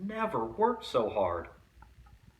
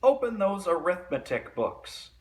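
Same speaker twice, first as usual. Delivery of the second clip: stern witch order; 8 semitones higher, 5 dB louder than usual. A narrator's reading. Miss Swamp orders class.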